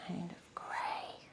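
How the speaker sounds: slow, very soft unvoiced whisper. Real, not acted. Painter mixing grey, whispering to herself without noticing. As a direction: breathe it out, absorbed in the brushwork. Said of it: "I don't know why I'm whispering".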